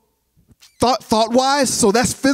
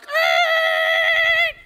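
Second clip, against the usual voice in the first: shocked squeal. Retold or imitated. Wife's shocked reaction.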